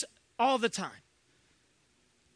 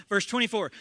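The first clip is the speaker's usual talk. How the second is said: usual speaking voice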